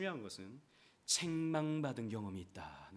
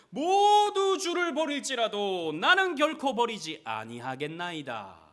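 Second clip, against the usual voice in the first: higher, louder and slow, defiant; Peter's bold vow; 8 semitones higher, 14 dB louder, slower than usual. Acted. Peter vowing never to leave Jesus, loud defiant vow. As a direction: swear it before everyone.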